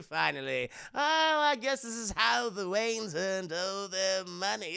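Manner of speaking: slow, theatrical villain purr with a laugh; a slow, gloating supervillain drawl; Joker voice. Retold or imitated. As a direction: play the Joker savouring the meeting. Joker voiced in retold story.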